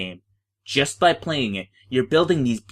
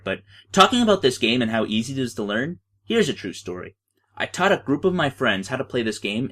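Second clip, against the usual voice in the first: ordinary delivery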